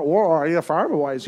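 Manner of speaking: lightly put-on rural farmer voice; a hint of a country farmer; farmer accent. Pastor jokingly imitates a local farmer, light rural accent. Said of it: "where have I got that accent from".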